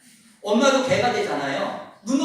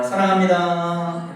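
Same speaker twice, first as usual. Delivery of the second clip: "사랑합니다" stretched out very slowly and loudly; next-style greeting; 2 semitones lower, 6 dB louder, much slower than usual. Shown as a demonstration. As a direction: drag out each syllable of "사랑합니다". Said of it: "자, 그다음 어떻게?". (아주 천천히 늘여서 "사랑합니다")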